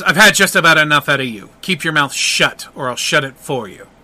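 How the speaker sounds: usual speaking voice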